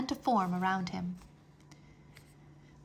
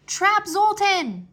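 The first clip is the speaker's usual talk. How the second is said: high, slow, loud command; 11 semitones higher, 8 dB louder, slower than usual. Acted. Drake and Nuna ordering the dragons to strike, loud command. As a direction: bark the order together. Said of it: "commanded at the same time".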